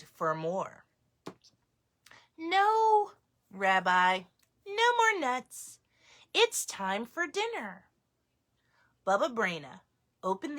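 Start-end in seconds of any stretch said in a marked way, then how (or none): 2.4-7.9 high firm grandma voice; grandmother voice. Acted. Baba Brena refusing more nuts, high firm.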